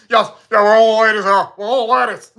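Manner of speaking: hammed-up mock Australian; broad, comic Australian impersonation; deep Australian accent. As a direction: imitate a thick Australian accent. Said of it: "had a deep, deep Austra".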